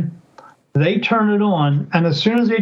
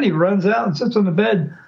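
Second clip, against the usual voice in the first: ordinary delivery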